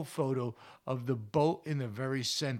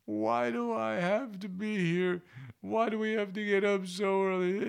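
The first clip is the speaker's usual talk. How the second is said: moany voice; 6 semitones higher than usual. Retold or imitated. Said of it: "in this moany voice going".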